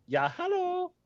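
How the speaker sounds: very high, cartoonish hello; high squeaky hello. Greeting in a high put-on voice.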